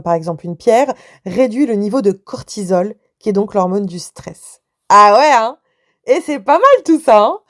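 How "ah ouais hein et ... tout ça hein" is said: louder and higher, genuinely impressed; excited. Real, not acted. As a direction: marvel at the finding out loud. Amazed by the cortisol study.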